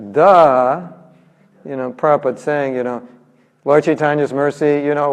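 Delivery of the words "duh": a blunt mocking duh; eye-rolling duh; sarcastic duh. Shown as a demonstration. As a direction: point out the obvious with scorn. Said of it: "what we say in America".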